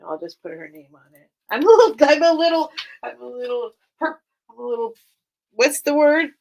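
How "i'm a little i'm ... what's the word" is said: flustered and emotional. Real, not acted. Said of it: "I'm emotional".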